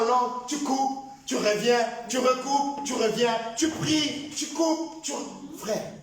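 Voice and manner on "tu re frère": normal talking voice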